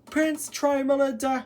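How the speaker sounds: high-pitched, excited calling out; excited storybook heroine, voice lifted high; excited holler. A narrator's reading. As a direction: call out in delighted recognition, pitched high. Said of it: "she hollered excitedly".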